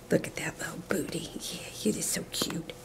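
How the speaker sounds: hushed, almost whispered pet talk; soft doting coo. Real, not acted. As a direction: coo softly to the puppy. Cooing to her dog.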